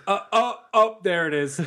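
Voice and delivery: loud, high mock alarm; Bradford. Friend watching someone throw up, loud mock alarm. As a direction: build a run of ohs into a loud announcement. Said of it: "And Bradford goes".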